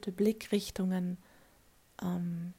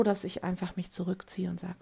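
usual speaking voice

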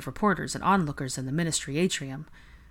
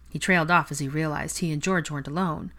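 plain reading voice

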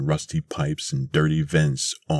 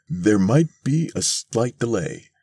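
lightly voiced smooth talker, pitched higher; stalling salesman; 7 semitones higher than usual. A narrator's reading. Mister Thurkell breaks bad news to the ladies, stalling. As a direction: put off the customers in a higher voice.

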